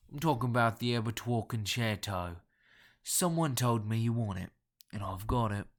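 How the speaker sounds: hoarse Cockney drinker's voice; guttural nasal Cockney. A narrator's reading. Caller offering the concerto, in guttural Cockney. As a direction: pitch the deal in thick Cockney. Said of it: "guttural yet nasal Cockney accent".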